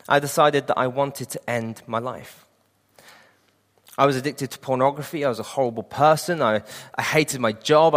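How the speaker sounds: ordinary talk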